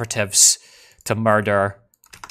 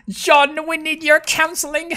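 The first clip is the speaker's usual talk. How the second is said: very high squeaky voice; high mock plea; silly falsetto. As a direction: squeak it like a cartoon character. Putting on a silly voice.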